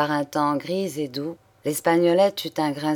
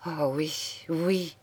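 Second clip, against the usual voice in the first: softer, faltering "oui"; a touch of stammering, said softly; stammered; 6 dB quieter, much slower than usual. Acted. Julien stammers softly, answering Thérèse. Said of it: "balbutia-t-il".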